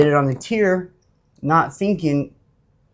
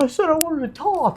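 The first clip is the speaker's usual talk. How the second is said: much higher whiny protest; whiny mock voice; 14 semitones higher than usual. Beaten inmate protesting he only wanted to talk, whining. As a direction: whine it like a beaten bully. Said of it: "He's like".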